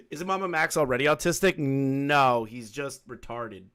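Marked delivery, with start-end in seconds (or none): none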